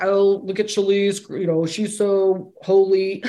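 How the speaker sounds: a light mock-admiring voice, slowed at the end; mocking admirer. Teacher mimicking people praising her holiness, mock admiring. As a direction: gently mimic someone gushing about how holy she is. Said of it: "this is not like".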